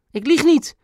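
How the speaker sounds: very high, indignant cry; called-out protest. A narrator's reading. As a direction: cry out the denial in a high boy's voice. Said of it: "riep Ben". (hoog uitgeroepen protest)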